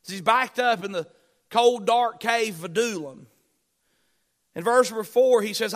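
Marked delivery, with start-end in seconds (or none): none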